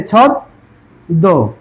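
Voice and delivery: ছদ্ম stretched out very slowly with a doubled দ; slow pronunciation demo. Shown as a demonstration. Teacher showing class the doubled sound in ছদ্ম, very slowly. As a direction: draw out ছদ্ম as ছদ্দো, slowly. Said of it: "দ্বিতীয় হবে".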